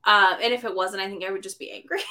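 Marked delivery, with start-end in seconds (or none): none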